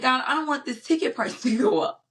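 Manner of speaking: high complaint with a laugh; pleading protest. Retold or imitated. Retold protest to God about ticket price, comic pleading. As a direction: plead upward, half laughing. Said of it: "I'm like".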